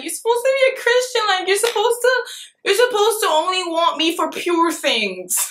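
high, whiny mock pleading; put-on high voice protesting his behavior; mock indignant. Girl silently reproaching him in her head, mock indignant.

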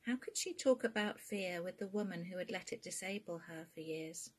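ordinary delivery

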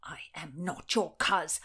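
restrained angry denial. Acted. Nephew rejecting his supposed uncle, restrained anger. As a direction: deny the kinship with contained anger.

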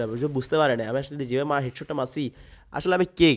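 usual speaking voice

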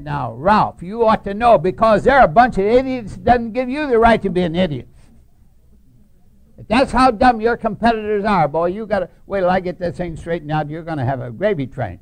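high, loud scolding of Ralph; loud lecture. Retold or imitated. Harry scolds Ralph about idiot competitors, loud and high. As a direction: tell him off with full force.

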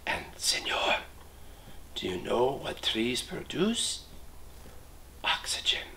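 whispering porcupines. Acted. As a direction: whisper it close to his ear. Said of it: "and whispered to the man".

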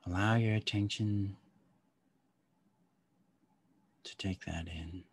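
calm guide easing them inward; guided meditation. Acted.

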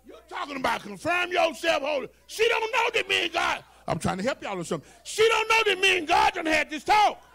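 high, shouted sermon cadence, stretching the punch words; full-force preaching. Acted. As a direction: drive the sermon home at full volume.

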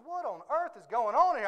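walk in and demand an explanation; baffled demand. Retold or imitated. Horse's owner walks in on scene.

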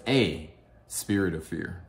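A said as a full AY, set apart; stress on A. Shown as a demonstration. Pointing out the scripture's wording, stress on A. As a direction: lean on A, pronounced AY. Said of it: "It doesn't say the".

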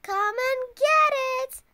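high, loud holler; loud high dinner call. Acted. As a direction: holler it to the whole house. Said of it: "hollered Froggy".

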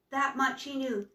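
ordinary delivery, nothing marked

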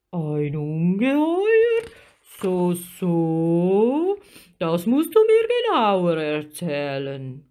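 slow, big creature voice, intrigued; drawn-out musing sea giant; giant octopus. A narrator's reading. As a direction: ponder it with stretched, swooping vowels. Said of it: "fragte der Krake".

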